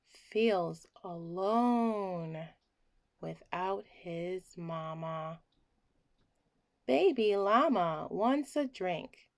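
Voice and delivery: plain reading voice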